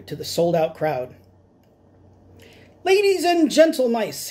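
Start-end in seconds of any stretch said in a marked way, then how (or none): 2.8-4.3 high, louder squeaky announcement; squeaky showman. Acted. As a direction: announce to the crowd like a tiny ringmaster. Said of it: "he squeaked".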